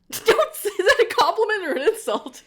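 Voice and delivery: squealing with laughter, don't say that; laughing protest. Real, not acted. Host protesting the cult leader joke, laughing high and loud.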